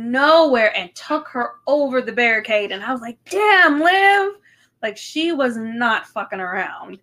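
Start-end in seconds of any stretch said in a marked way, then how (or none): 3.2-4.3 high, loud cry of amazement; impressed exclamation. Retold or imitated. Fan recalling the wrestler's surprise takedown, impressed shout. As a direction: whoop it out, re-enacting an awed reaction. Reacting aloud to the wrestler's attack.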